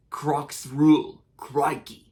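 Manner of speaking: put-on slow, broad Aussie accent; Steve Irwin Australian accent. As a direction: drawl it like the Crocodile Hunter. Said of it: "that's basically my Steve Irwin Australian accent".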